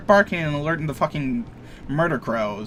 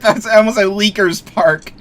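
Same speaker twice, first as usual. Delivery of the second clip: laughing; 6 semitones higher, 8 dB louder than usual. Real, not acted.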